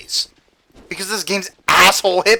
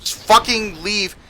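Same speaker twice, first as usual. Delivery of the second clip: ordinary talk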